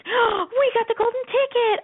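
put-on high, slow, drawn-out child cry; mock-rapturous kid voice; awestruck kids. Kids finding gold-foil Pop-Tarts, awestruck joy. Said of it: "they felt all Willy Wonka".

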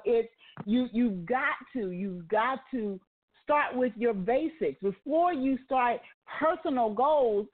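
ordinary talk

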